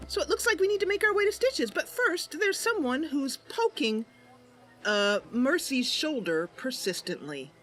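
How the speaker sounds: ordinary talk, nothing marked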